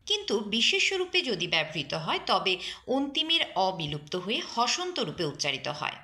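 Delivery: usual speaking voice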